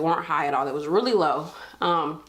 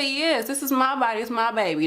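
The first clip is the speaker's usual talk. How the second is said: put-on higher, defiant "my body, my baby"; sassy retort; 6 semitones higher than usual. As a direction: put your foot down with attitude, pitched up. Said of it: "I said".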